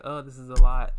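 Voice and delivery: loud, overwhelmed cry; uncomfortable outburst. Retold or imitated. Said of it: "she's like".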